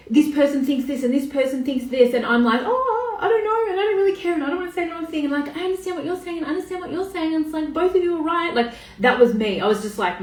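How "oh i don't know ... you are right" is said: high-pitched, hammed-up noncommittal voice; mock yourself dodging an argument in a high voice; wishy-washy people-pleaser. Former self dodging a politics argument, high mock people-pleaser. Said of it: "I was the person who was like".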